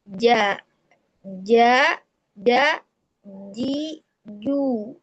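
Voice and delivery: "Ja, ja, ja, ji, ju" said one by one, mid-tongue pressed to the palate; jim articulation demo. Shown as a demonstration. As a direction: sound out "Ja, ja, ja, ji, ju" as separate syllables with a firm jim. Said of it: "dirasakan oleh kita si tengah-tengah lidah itu menempel".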